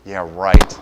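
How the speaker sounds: sarcastic, disbelieving retort; dismissive scoff. Retold or imitated. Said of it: "laughing at God and saying".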